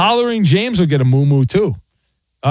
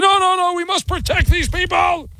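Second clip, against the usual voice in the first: high-pitched mock protest; mocking high voice of alarmed hangers-on; panicky jock sniffer. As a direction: plead in a shrill, hammed-up fuss. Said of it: "the jock sniffers out there like".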